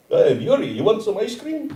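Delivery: mock Russian accent, pitch climbing. Imagined Russian woman offering Yuri ice cream, comic Russian accent. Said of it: "Or how about Russian?".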